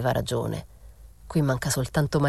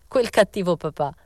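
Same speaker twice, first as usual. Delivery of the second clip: mild, mock-scolding exclamation; esclamazione; 3 semitones higher than usual. A narrator's reading. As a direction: exclaim it with some punch. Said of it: "esclamò". (esclamazione vivace ma contenuta)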